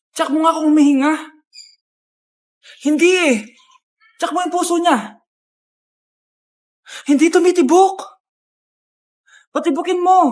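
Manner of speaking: loud urgent calls; panicked shouting. Acted. Friends panicking over the fainted crush, loud and urgent.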